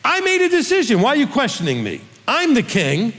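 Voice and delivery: sudden high, affronted king voice; king snapping at the men who question him; indignant king. Retold or imitated. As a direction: play David as a put-out king demanding obedience. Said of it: "David's doing the same thing right here".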